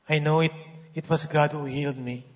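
ordinary delivery

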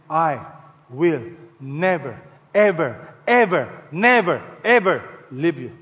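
slow, every "never" and "ever" set apart; emphatic double negative. Acted. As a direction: hammer each "ever" after a short pause. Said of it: "It's called the double negative".

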